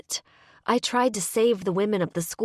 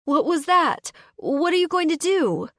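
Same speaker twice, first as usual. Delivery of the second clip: high, loud, alarmed questions; scared girl pressing Zane for answers; anxious; 12 semitones higher, 5 dB louder than usual. Acted. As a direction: demand answers in fright. Addie frightened by the call.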